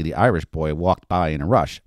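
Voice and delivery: ordinary delivery, nothing marked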